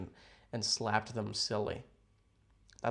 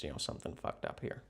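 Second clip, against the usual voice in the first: whisper; 9 semitones lower, 8 dB quieter, faster than usual. Real, not acted. Aside about messy hair.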